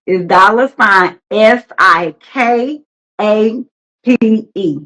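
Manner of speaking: slow dictation with gaps between the letters; slow spelling. Shown as a demonstration. Host spelling out a cash app name for viewers, slowly. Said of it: "We're gonna say it slow".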